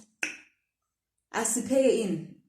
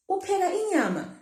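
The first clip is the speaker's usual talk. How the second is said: "Upheka inyama" pitched very high, as a question; question tune; 11 semitones higher than usual. Shown as a demonstration. Teacher asks "Upheka inyama" in a raised question tune. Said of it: "when it's a question".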